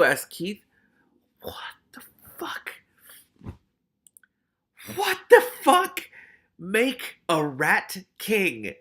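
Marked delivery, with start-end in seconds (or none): 1.4-2.8 slow, hushed disbelief; stunned whisper. Real, not acted. Reader stunned by the story's secret, whispering. 4.9-6.1 very high, loud, slow disbelief; shocked outcry. Real, not acted. Reader appalled by the story's secret, crying out. 6.6-8.7 very slow, high, loud confession; bursting out. A narrator's reading. Ratcatcher Two confessing the guild secret, bursting out. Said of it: "Ratcatcher Two burst out".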